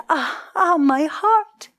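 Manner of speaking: high, aching stylized sigh; voiced sigh of heartache; storyteller sighing as the suffering queen. A narrator's reading. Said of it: "She sighed".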